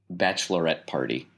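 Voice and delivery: normal talking voice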